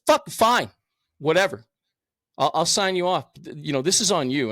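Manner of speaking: irritated doctor caving in to a stubborn patient; exasperated surgeon giving in. Retold or imitated. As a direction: throw up your hands and sign it off. Angry neurosurgeon gives in.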